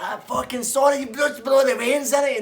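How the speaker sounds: high, loud outraged yell; angry shout. Retold or imitated. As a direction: shout him down in disbelief. Gary mocking apology, shouting.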